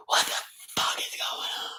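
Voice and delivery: voiceless mock-shocked whisper; hushed, hammed-up bewilderment; stunned whisper. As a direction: whisper it in disbelief, as a retold aside. Retelling stunned reaction among the beings.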